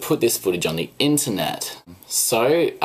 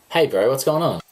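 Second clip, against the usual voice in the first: usual speaking voice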